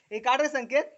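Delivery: high, loud coaxing; urging shout. Real, not acted. Uncle loudly coaxes Sanket to make the dog sound. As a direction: push him on with a shout.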